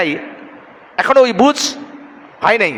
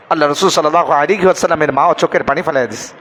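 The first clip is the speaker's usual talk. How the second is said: normal talking voice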